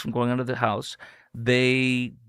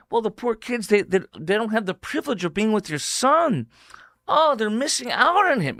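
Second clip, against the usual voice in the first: much higher, sing-song sarcasm; sarcastic mock pity; 10 semitones higher than usual. Host mocking columnist's backhanded pity, in a high sarcastic voice. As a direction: lay on fake sympathy, sneering. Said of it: "He goes".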